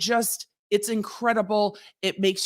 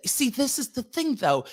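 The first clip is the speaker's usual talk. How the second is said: ordinary delivery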